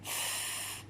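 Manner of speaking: "f" as a long soft fff; sustained fff, no vowel; isolated letter sound. Shown as a demonstration. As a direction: hold only the voiceless sound "f". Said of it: "On entend le son".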